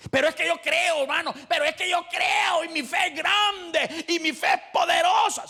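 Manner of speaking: loud, high, boastful protest; hammed-up proud believer shouting his faith.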